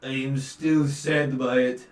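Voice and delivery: grieving tavern keeper voice; sad old innkeeper. Acted. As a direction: mourn the lost girl slowly. NPC voice.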